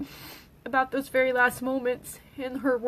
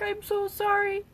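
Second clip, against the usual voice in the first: crying, voice pitched far up; high, tear-choked sorry; sobbing apology; 9 semitones higher than usual. Real, not acted. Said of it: "I was, like, crying".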